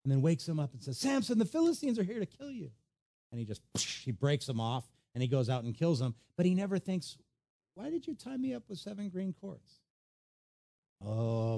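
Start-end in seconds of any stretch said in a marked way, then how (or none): 1.0-2.7 high-pitched mock alarm; Delilah. Delilah waking Samson to a false threat, high mock alarm. 7.7-9.8 high, soft mock puzzlement; lightly acted bewilderment, raised and hushed; puzzled question. Samson never thinking to question Delilah, high mock puzzlement.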